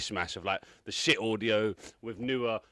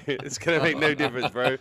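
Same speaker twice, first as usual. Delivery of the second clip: laughing; 4 semitones higher, 6 dB louder than usual. Real, not acted. Repeats punchline laughing.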